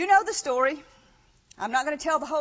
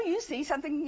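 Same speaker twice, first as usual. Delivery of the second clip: ordinary delivery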